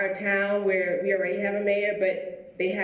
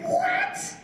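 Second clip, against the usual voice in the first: squeaky, much higher disbelieving "What?"; hearing a day is named after her, much higher squeak; shocked what. Real, not acted. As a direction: gasp it out in total disbelief.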